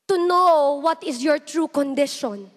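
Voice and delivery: usual speaking voice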